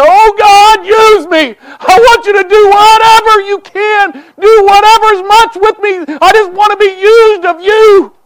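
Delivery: loud, high, tearful plea; crying out to God. Retold or imitated. Son pleading with God to use him, crying out loud and high. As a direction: cry out the prayer as if kneeling at the altar. Retold prayer at the altar.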